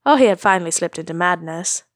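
ordinary delivery

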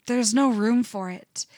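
usual speaking voice

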